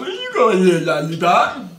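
high, put-on silly voice; mimicking the character. Reactor quoting the character chasing lollipop, high mimicking voice. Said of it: "Talking about".

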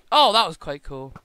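ordinary talk

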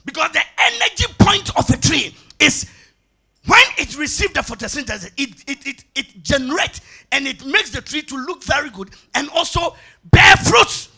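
loud preaching, stretched key phrases; forceful preaching. Acted. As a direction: drive the point home loudly. Preaches with raised voice.

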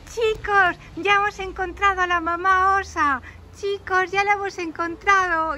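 high-pitched announcement of good news; excited calling out. Acted. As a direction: call out the discovery to the children. Finders call out to the children.

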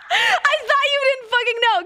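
high-pitched, laughing squeal of surprise; real laughing disbelief. Real, not acted. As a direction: squeal it through a burst of laughter. Laughing at the chat's guess.

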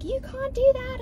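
high-pitched imitation of a busybody; mock public scolding. Imitating strangers picking fights in public, mock scolding. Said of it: "They'll just come and yell at you".

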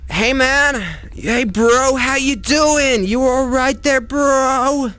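high, slow friendly bro voice; fake-chummy dude talking to a monster; mock bro greeting. Gamer greets creepy creature in a high, slow bro voice.

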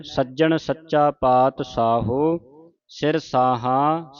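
ਸਾਹਾ said in full, slow and clear; ਸਿਰ ਸਾਹਾ recited slowly with the full ਸਾਹਾ; ਸਾਹਾ fully voiced before pausing; correct pronunciation. Shown as a demonstration. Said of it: "ਸ਼ੁੱਧ ਉਚਾਰਨ ਹੈ".

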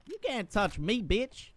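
playful put-on bully voice; cocky Cletus voice. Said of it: "My name is Cletus".

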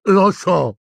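high, loud burst; furious boy's outburst; roared. Acted. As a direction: roar it back, high and loud. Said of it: "ruggì Neville".

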